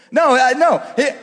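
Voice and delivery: usual speaking voice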